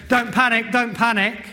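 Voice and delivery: high, loud mock panic; Dad's Army character. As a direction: imitate a panicking British sitcom soldier. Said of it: "another who said".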